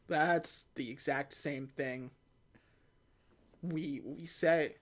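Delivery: low, slow, quiet retold line; hushed, halting recognition; slow realization. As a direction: draw it out softly as it dawns on you.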